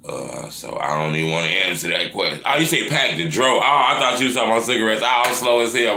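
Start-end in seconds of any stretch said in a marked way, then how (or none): 2.5-6.0 sudden realization. Real, not acted. Realizes he misread the question.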